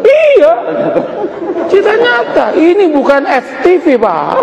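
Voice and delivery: very high-pitched protest; comic, high-voiced assurance to the crowd; amused insistence. Real, not acted. Insists to doubting audience, amused.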